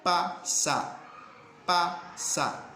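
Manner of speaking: pasa said slowly, lips closing on the p; teacher demonstrating the sound; demo word pasa. Shown as a demonstration. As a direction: model pasa for the class, lips pressed.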